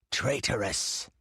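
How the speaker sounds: slow, venomous accusation, lightly voiced; furious hiss. A narrator's reading. The priest throttles the girl, hissing with fury. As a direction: spit it like a cobra. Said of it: "his voice hissed like a cobra".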